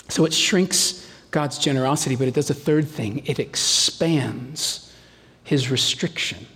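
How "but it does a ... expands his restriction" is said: ordinary talk, nothing marked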